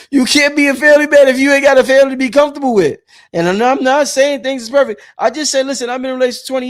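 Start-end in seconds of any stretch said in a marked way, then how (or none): 0.1-3.0 higher, louder and fast, driving the point home; emphatic loud restatement. Acted. Vlogger to viewers, repeating his point loudly and fast. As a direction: hammer the line home a second time.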